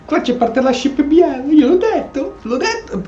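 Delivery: high, loud, told-you-so glee; real excited I-said-so with a laugh; gleeful. Real, not acted. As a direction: gloat that you called it. Gloating about predicted romance.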